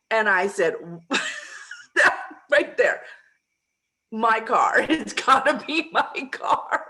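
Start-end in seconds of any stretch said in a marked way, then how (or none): 1.1-6.9 louder, higher, breaking into laughter; retold excitement bubbling over into laughter; laughing delight. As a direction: claim it gleefully through laughs. Retells claiming the car, laughing.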